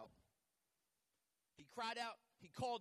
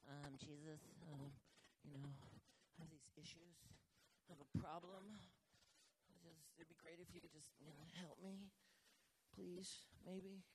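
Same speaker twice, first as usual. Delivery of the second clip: much softer, hesitant mumbled plea; timid mock prayer; 11 dB quieter than usual. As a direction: parody someone praying half-heartedly. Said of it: "Don't be like".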